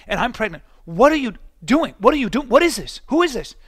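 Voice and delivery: imitating an incredulous, scolding wife; exasperated wife. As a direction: act out a pregnant wife's outraged telling-off. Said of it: "and my wife goes".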